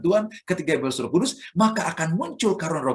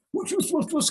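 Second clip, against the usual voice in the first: rushing tongues; 13 semitones higher than usual. Shown as a demonstration. Preacher showing his own first tongues, airy whooshing. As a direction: breathe out whooshes like wind. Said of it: "saya berbahasa roh nih".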